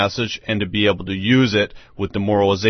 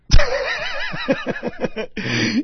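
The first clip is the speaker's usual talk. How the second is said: high laugh. Real, not acted. Amused laugh mid-story.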